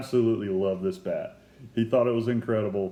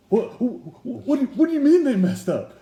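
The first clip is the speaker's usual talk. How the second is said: high, louder stammering panic; panicked disbelief; 12 semitones higher, 6 dB louder than usual. Retold or imitated. Fan panicking at the player's claim, high and stammering. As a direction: sputter in sudden alarm. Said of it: "And I'm like".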